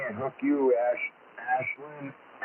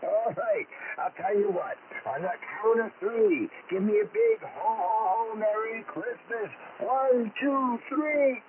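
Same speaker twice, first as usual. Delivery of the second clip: put-on higher, hearty Santa Claus with lots of oh boys; jolly Santa voice; 10 semitones higher than usual. Santa on the radio greeting kids, jolly and hearty. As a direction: play a cheerful Santa over the radio to excited kids.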